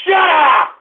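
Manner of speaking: angry shout. Real, not acted. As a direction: bark it at the callers. Host yelling at prank callers.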